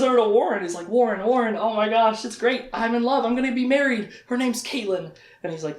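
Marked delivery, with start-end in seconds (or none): 0.9-5.1 high, gushing announcement; giddy excitement. Acted. Richard telling Warren he'll marry, high excited gush. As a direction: burst in with happy news. Acted breathless news to Warren.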